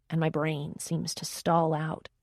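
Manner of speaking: usual speaking voice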